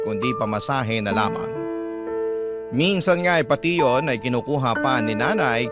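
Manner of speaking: normal talking voice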